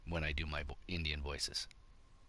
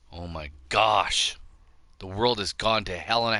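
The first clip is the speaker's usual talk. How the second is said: exasperated; 2 semitones higher, 7 dB louder than usual. Real, not acted. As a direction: drag it out like you can't believe it. Exasperated at the state of the world.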